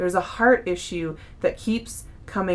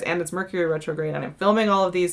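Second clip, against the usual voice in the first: normal talking voice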